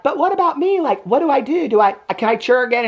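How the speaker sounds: high mock whine of a worried teen; whiny plea. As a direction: plead like a spoiled cheerleader. Other girls pleading, whiny.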